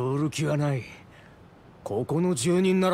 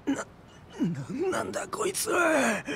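shocked outburst; 4 dB quieter than usual. Acted. Gang member reels after losing fight.

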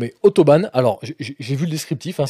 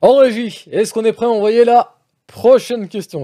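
loud call to the control room, higher; 8 semitones higher, 5 dB louder than usual. Real, not acted. Host calling loudly to the control room. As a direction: call out to the booth, pitched up.

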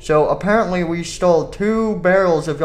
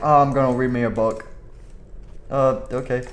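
ordinary talk, nothing marked

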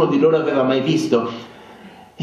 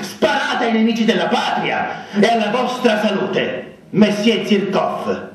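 put-on defiant drunken toast; 8 semitones higher than usual. A narrator's reading. Narrator toasting Zirkov to insult him, defiant. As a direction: lash out in a grand mock toast.

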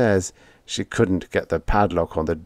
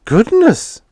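much higher, louder startled cry; much higher startled exclamation; shocked goodness; 8 dB louder than usual. A narrator's reading. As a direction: gasp it out in shock.

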